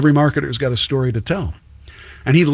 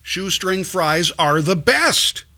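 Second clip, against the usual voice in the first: higher-pitched, insistent mock protest; protesting commenters; 5 semitones higher than usual. Host voices commenters defending shoestring fries, mock protest. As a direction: plead the case like an indignant fan. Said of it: "people commenting, say".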